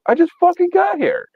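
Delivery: very high, indignant whine; high outraged whine. Retold or imitated. Annoyed guy just arrived at the party, whining high. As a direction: squeak out the complaint. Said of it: "I'm like".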